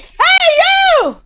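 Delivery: high, loud yelling challenge; character shouting after the cloaked girl to stand and fight. Acted. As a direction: bellow at the fleeing figure. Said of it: "I just shout back".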